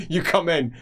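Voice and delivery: normal talking voice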